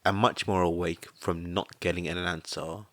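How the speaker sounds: ordinary delivery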